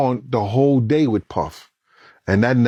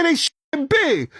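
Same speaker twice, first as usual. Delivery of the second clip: high, tense words under fire; alarmed report; 14 semitones higher than usual. Retold or imitated. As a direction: blurt it out in shock. Driver explains during shooting.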